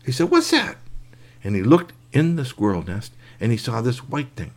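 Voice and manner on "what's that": much higher, startled question; high surprised. Acted. Boy looking up at the nest, high startled question. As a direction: squeak it out surprised.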